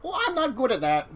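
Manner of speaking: high creature voice; squeaky bug monster; cockroach voice. Acted. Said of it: "I like my cockroach voice".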